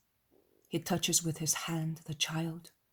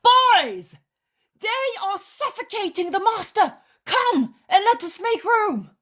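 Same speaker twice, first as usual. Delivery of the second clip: very high, full-voiced alarm; urgent shout; 12 dB louder than usual. A narrator's reading. Peter calls the disciples to rescue the master, urgent shout. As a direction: shout high over the crush to rally help. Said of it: "and he shouts".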